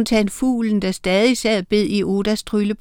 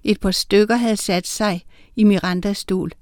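ordinary delivery

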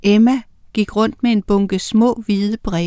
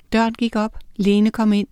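plain reading voice

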